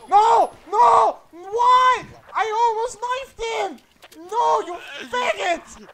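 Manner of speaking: screaming furious at a teammate; furious high yell; angry yelling. Real, not acted. Angry yelling after teammate's shot.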